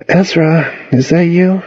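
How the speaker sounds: high-pitched, lightly voiced sleepy girl; sleepy mumble. A narrator's reading. Girl woken by boy's phone call, mumbling. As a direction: puzzle over who is calling, barely awake. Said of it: "she mumbled".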